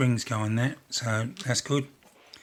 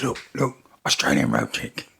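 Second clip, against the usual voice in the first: punchline dropped much lower in a comic magician voice; Tommy Cooper punchline; 10 semitones lower, 3 dB louder than usual. Retold or imitated. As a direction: land the gag low like a stage magician. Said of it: "He says".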